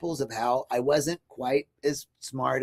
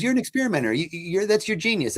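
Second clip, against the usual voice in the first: higher, lightly voiced friend; a hint of an admiring friend, pitched up; friend's praise quote; 7 semitones higher, faster than usual. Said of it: "He goes".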